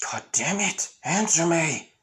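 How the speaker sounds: high, strained exasperation; frustrated demand. Retold or imitated. Villain to silent Asta, frustrated demand. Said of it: "With the guy saying".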